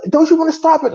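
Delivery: high-pitched imitation of a passenger's question; voiced girlfriend. Girlfriend to driver passing a burning car, high imitation. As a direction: put on a high voice, asking the driver.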